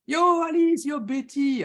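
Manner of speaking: high, loud, excited shout-out; whooping hello, easing slightly on the second name; calling out greetings. Real, not acted. Greeting viewers joining the live.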